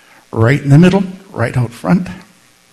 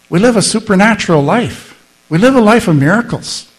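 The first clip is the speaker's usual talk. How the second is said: 1 preaching climax; 7 semitones higher, 5 dB louder than usual. Real, not acted.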